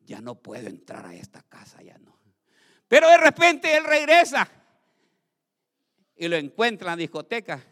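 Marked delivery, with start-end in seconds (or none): 0.1-2.2 gasp, then hushed, low whisper; acted hushed retreat; demon giving up. Voices the demon finding entry barred. 2.9-4.5 loud, high burst; startling preacher's cry; sudden dramatic shout. Acted. Sudden dramatic return of the spirit.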